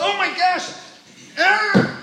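high, loud joyful cry; high excited gasp of recognition; overjoyed gasp. Acted. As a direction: burst out in joy at seeing him. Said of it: "she goes".

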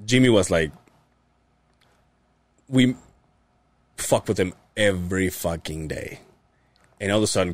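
ordinary delivery

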